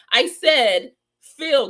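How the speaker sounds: "Feel" said alone, high and loud with a falling end; stress on feel. Real, not acted. Host correcting Pig Snake, stressing "Feel" hard. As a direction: punch the single word "Feel". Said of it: "the difference in feel".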